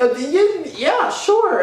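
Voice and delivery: very high, stammering; stunned squeak. Retold or imitated. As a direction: squeak it out in shock. Stunned reply to [name].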